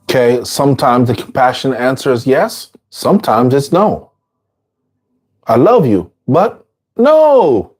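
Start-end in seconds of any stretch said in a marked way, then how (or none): 5.5-7.7 high, bright mock voice saying no lovingly; kind but firm no. Teacher voicing a friend who says no kindly, high and firm. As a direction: refuse gently but firmly, pitched up.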